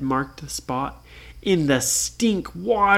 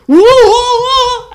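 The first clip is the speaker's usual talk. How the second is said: very high, loud, falling whoas; startled whoa; 13 dB louder, slower than usual. Acted. Guard falling over the side, high startled whoas. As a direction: topple off the ship yelling. Pirate falls overboard.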